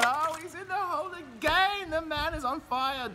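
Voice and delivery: high, thrilled announcer call; excited call. Pretend. Announcer thrilled as the putt drops again. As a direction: let the excitement burst out.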